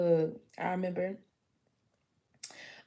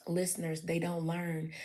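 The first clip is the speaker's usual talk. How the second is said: usual speaking voice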